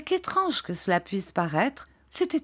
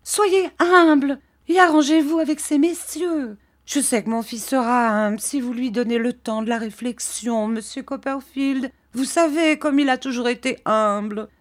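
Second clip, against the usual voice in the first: put-on higher, imploring old mother's voice; pleading mother; 6 semitones higher, 4 dB louder than usual. A narrator's reading. Mrs Heep pleading with the gentlemen, higher and imploring. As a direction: beg the gentlemen to spare him.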